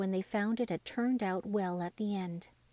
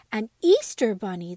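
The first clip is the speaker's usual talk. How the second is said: louder, delighted exclamation; excited cry; 9 dB louder than usual. Acted. Dolls jumping and clapping, crying out in delight. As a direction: call it out with glee. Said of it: "the dolls all cried".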